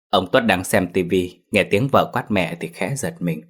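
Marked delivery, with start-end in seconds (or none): none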